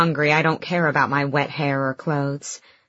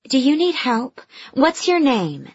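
concerned receptionist; 9 semitones higher than usual. Acted. Receptionist questions wet stranger.

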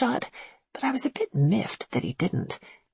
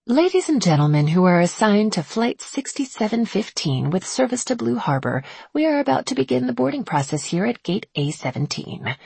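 higher-pitched, projected announcer voice; airport boarding announcement; 4 semitones higher, 4 dB louder than usual. Acted. Gate agent calls passengers to board, announcer voice.

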